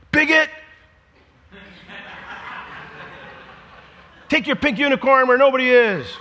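high, loud, hammed-up yell; mock yell. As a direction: yell it at the unicorn guy. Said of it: "I'm gonna go yell at that guy".

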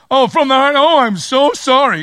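high, loud overdone fake apology; theatrical apology. Child mocking the demand with a loud theatrical sorry. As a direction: ham up a mock sincere sorry. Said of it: "you're supposed to get theatrical now".

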